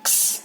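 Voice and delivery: क्स as a voiceless "ks" with no vowel; क्स said as a bare "ks"; phonic X sound. Shown as a demonstration. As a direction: sound out क्स, letting the s trail. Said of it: "pronounce करेंगे क्स".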